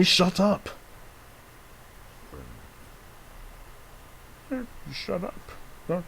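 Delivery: high-pitched toy voice telling someone off; squeaky snap. Pretend. Toy character shushing back at a friend, high snap.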